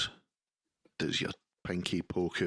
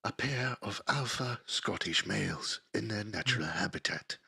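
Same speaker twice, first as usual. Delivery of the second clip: nature documentary; 8 dB louder than usual. Pretend. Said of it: "David Attenborough's voice".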